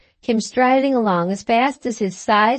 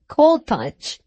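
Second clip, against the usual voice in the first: usual speaking voice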